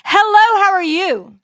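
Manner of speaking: loud, high, bright customer-service greeting; professional voice. Retold or imitated. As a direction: put on the polite work voice. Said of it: "I get my professional shit going on".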